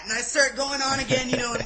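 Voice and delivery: usual speaking voice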